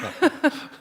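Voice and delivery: brief high breathy laugh; short laugh. Real, not acted. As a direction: laugh it off at yourself. Laughs at own joke.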